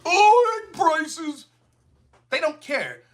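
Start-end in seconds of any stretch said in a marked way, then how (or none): 0.0-1.4 mocking, high-pitched sneer; mocking whine. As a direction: mimic a whiny Democrat, pitched way up. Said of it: "they're like".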